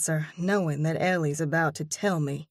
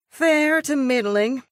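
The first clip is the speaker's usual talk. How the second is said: sighing; 9 semitones higher, 6 dB louder than usual. A narrator's reading. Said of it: "she sighs".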